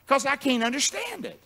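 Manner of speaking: normal talking voice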